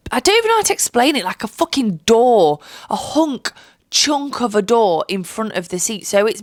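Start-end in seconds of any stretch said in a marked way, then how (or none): 0.1-5.0 exasperated rant. Real, not acted. Ranting about blocked legroom.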